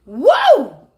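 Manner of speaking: loud startled whoa; a big hammed-up gasp of shock; exaggerated loud whoa at the ring; mock surprise. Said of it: "you be like".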